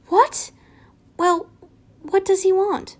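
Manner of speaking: high startled question; shocked. Acted. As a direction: blurt it out in alarm. Shocked Harry reacts.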